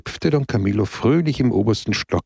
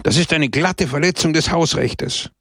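raised, outraged complaint; indignant protest; 6 semitones higher than usual. Acted. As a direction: let the outrage spill out, pitched high. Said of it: "seiner Empörung freien Lauf zu lassen".

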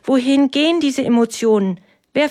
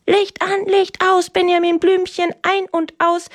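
put-on high sing-song child's command from the top bunk; high bossy singsong; big brother directing; 9 semitones higher than usual. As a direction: call it down like a bossy older brother. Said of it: "von oben herab aus dem Stockbett dirigiert".

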